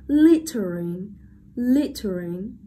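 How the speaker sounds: slow teacher's model of littering, first syllable strong; stress on first syllable. Shown as a demonstration. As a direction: hit LIT, keep the rest of littering light. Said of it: "trọng âm rơi vào âm tiết đầu tiên".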